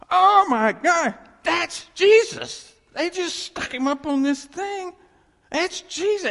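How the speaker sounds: high, loud, slow cry of discovery; amazed realization. Retold or imitated. Preacher reliving his discovery for the congregation, high amazed cry. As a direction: shout it out in awe, stretching the words.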